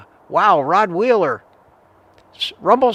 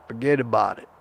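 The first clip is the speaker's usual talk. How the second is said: lightly voiced New York mobster accent; a hint of a tough-guy New York gangster; New York gangster impression; 4 semitones lower than usual. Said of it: "that's my gangster impression".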